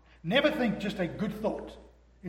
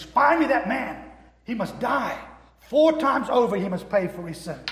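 furious royal verdict; 5 semitones higher, 7 dB louder than usual. Retold or imitated. Preacher voicing King David condemning the rich man, angry. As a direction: pronounce the death sentence in rising fury.

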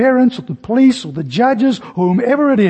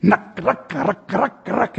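soft creaking ruck sounds; hushed mimic of the toy's creak; rocking creak; 2 semitones higher, 5 dB quieter, much slower than usual. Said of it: "started to make a loud noise".

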